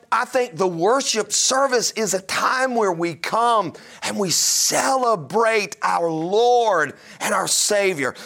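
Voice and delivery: ordinary talk, nothing marked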